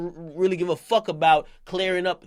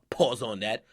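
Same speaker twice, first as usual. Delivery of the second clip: usual speaking voice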